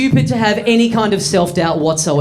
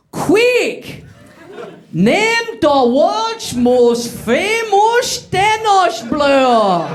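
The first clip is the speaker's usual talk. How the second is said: high, slow, over-the-top fake Dutch accent; a bad Dutch accent stretched out slow; 7 semitones higher, much slower than usual. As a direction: ham up a made-up Dutch accent. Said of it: "the intensity of the Dutch accent".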